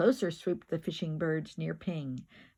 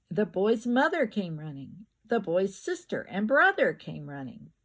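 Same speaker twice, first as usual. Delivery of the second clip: ordinary delivery, nothing marked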